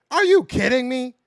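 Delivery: loud incredulous outburst; exasperated outburst. Real, not acted. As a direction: blurt it out in angry disbelief. Outraged at player's tweet after loss.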